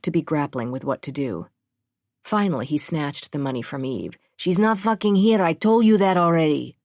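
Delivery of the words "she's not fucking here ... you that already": angry. Acted. Angry super refuses.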